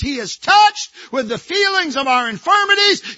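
shouted high and loud, touched ringing out; triumphant pulpit shout; proclaimed verse. Acted. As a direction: cry the word touched out to the church.

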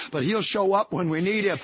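normal talking voice

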